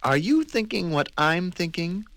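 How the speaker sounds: conspiratorial; fussy scheming voice; sly prim question. Acted. Mister Neat schemes.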